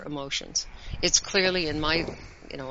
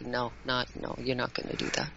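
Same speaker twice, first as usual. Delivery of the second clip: lower, softer matter-of-fact no; quiet firm refusal; 4 semitones lower, 7 dB quieter than usual. Retold or imitated. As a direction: set a limit in a low, soft voice. Acted firm refusal to child.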